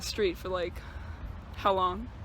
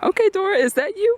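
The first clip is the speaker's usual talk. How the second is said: teasing; 8 semitones higher, 3 dB louder than usual. Real, not acted. Teasing friend with map.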